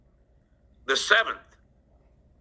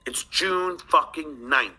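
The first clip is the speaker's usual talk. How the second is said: ordinary talk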